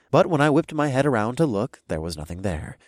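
ordinary delivery, nothing marked

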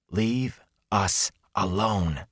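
each word drawn out and set apart; measured, word-by-word threat; slow, deliberate warning. Acted. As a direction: space out the words as a final warning. Stern warning repeated, word by word.

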